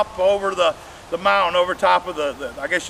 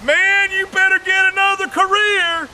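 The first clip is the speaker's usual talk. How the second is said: mock advice; 11 semitones higher than usual. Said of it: "And I'm like".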